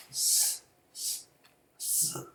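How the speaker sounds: 쓰 as a long unvoiced hiss; 쓰 hissed quietly through the teeth; hissing fricative. Shown as a demonstration. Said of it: "쓰 이렇게 긁으면서 나는 소리".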